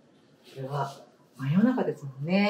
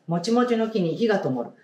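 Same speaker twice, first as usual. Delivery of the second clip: story reading; 4 semitones higher, 3 dB louder than usual. Acted. Teacher reading a picture book to the class, storybook voice. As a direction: clear storybook read-aloud voice.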